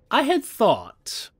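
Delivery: slow, puzzled drawl of memory; drawn-out musing. Real, not acted. Gamer musing to viewers, slowly drawn out and high. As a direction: stretch I had thought slowly and high.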